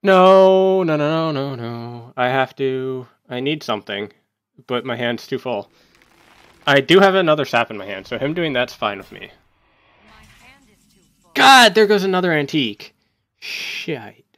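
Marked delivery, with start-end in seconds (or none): 0.0-2.1 loud, high drawn-out no, trailing down; dismayed outcry. Real, not acted. Dismay at a bad game turn. 11.3-14.2 loud, high exasperated cry; exasperated, voice jumping up; frustrated outburst. Real, not acted. Frustrated as a card is lost.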